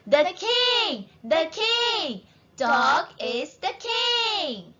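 high, cheering shouts for a king; crowd shout. A narrator's reading. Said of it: "Everyone shouts".

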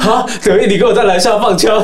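high, loud teasing reproach; mock exasperated yell. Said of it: "我当时看".

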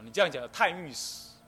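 ordinary talk, nothing marked

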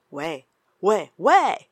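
shouting hello down a dead line; loud, rising hellos into the receiver; loud calling into the phone. A narrator's reading. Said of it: "大声叫道".

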